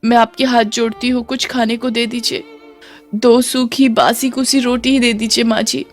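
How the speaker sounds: acted, plaintive high plea; pleading. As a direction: beg meekly for a scrap of food. Starving daughter-in-law begs for food.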